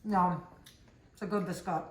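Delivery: talking through a mouthful; words round a bite of food; mouth full. Real, not acted. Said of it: "Sorry, I'm talking with my mouth full".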